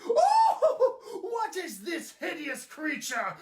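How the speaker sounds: high shrieking gasp then sneer; disgusted old lady. Acted. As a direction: recoil at an ugly thing. Intruder spots the toy.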